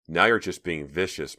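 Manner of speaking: usual speaking voice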